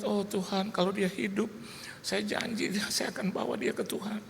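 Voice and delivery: high, hushed begging prayer; tearful pleading. Retold or imitated. Father begs God to let his baby live, pleading.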